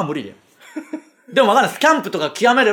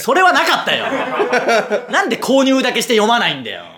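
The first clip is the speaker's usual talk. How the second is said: high-pitched mock outrage through laughter; comic scolding; 4 semitones higher than usual. Host berating listener over unread magazines, laughing comic scolding. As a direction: scold them, half laughing. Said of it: "皆さんの失敗を即興でのしる".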